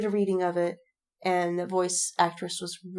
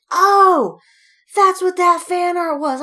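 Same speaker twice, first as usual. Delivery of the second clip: high, loud, slow dawning oh; realization; 10 semitones higher, 10 dB louder, slower than usual. Retold or imitated. Podcaster retelling realizing what fan art showed, high dawning surprise. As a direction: let it dawn on you out loud.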